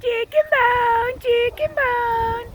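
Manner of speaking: high, very slow singsong name call; drawn-out calling. Real, not acted. Owner calls rooster Chicken Bone, drawn-out calling. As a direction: stretch each syllable out high.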